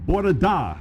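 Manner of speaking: a learner's careful Bore da; Welsh attempt. Shown as a demonstration. Part-Welsh speaker tries a Welsh greeting. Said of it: "Uh, Welsh".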